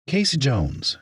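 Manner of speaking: high-pitched Southern introduction; Southern drawl. A narrator's reading. Florist introduces herself to a stranger, Southern drawl. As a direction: give the name with a Southern drawl. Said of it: "she replied in her southern drawl".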